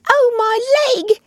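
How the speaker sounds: high, loud drawn-out wail of pain; pained cry. A narrator's reading. Mole crying out after tripping in the snow, pained cry. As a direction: cry out slowly and sharply in pain. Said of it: "he cried".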